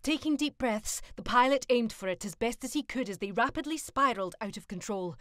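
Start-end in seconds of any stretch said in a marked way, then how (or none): none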